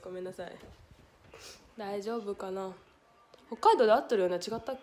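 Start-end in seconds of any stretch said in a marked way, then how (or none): none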